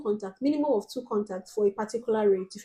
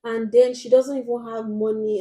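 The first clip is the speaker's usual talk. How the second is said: ordinary talk, nothing marked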